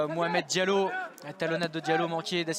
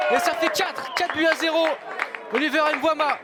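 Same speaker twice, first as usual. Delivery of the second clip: higher, excited scoreline call; excited goal call; 7 semitones higher than usual. Real, not acted. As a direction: announce the goal with energy. Fourth goal called.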